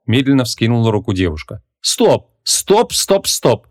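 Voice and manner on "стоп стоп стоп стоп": higher, insistent repeated stops; a raised flustered halt; urgent stop. A narrator's reading. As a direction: cut him off again and again. Vanessa halting the wizard.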